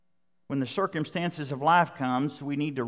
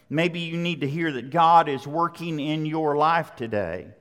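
ordinary talk, nothing marked

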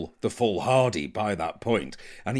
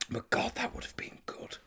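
hushed longing; 7 semitones higher, faster than usual. Real, not acted. Regretting a show that never got made, whispered. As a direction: whisper it under your breath, wistfully. Wistful aside about unmade series.

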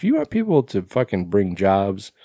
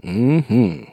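odd mm-hmm. Real, not acted. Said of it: "I don't know why I said it like that".

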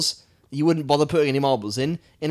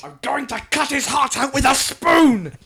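high, loud, slow hammed-up villain threat; quoting the villain; 11 semitones higher, 6 dB louder, slower than usual. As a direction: shout the spoon line like a ranting villain. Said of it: "bad guys always get the best lines".

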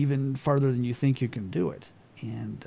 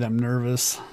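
normal talking voice

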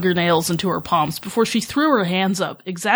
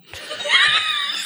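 high squealing laugh. Real, not acted. Writer embarrassed by her own fanfic title, squealing laugh.

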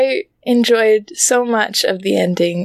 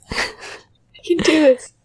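laughing; 4 semitones higher than usual. Real, not acted. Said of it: "I can't stop laughing now".

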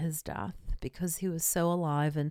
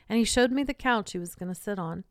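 usual speaking voice

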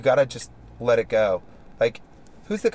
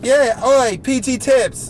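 loud, high yelling; excited shout; 12 semitones higher, 8 dB louder, much slower than usual. Real, not acted. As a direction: yell it out with thrill. Shouting on the downhill ride.

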